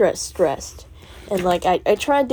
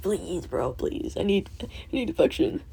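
mock pleading; 5 semitones higher, 5 dB quieter than usual. Pleading for teacher's affection.

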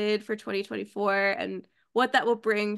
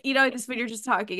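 ordinary talk, nothing marked

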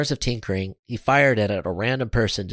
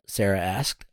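ordinary delivery, nothing marked

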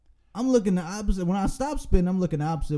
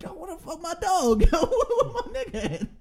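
high, laughing yo what the fuck; laughing panic; 12 semitones higher than usual. Retold or imitated. After the crash, calling for his missing friend, laughing. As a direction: laugh through the shout for your friend. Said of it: "I'm like".

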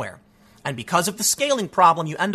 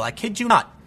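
usual speaking voice

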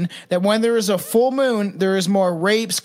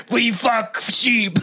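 put-on French accent; slower than usual.

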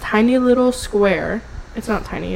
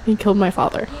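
ordinary delivery

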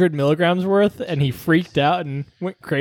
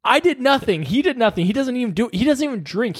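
retold protest in a raised pitch; mildly indignant, higher-pitched defense; insistent denial; 8 semitones higher than usual. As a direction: plead innocence, pitch climbing. Retold denial to suspicious staff.

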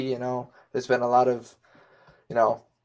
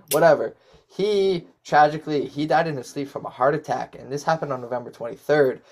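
normal talking voice

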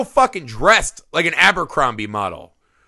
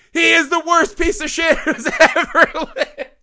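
much higher, laughing through the outrage; laughing disbelief; 10 semitones higher than usual. Retold or imitated. Cracking up while trashing the on-screen dad to a co-host, laughing. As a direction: squeal it out in amused disbelief. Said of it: "I was like".

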